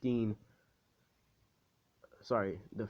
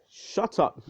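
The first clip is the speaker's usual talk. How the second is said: high, sudden retort; acted exasperated yelp, much higher; mock angry outburst; 10 semitones higher, 4 dB louder than usual. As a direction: cut them off with a high-pitched cry.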